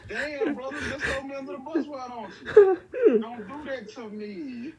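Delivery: loud laughing fake cry; laughing mock wail. Real, not acted. Brother exposes him, mock wail.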